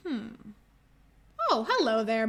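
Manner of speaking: ordinary delivery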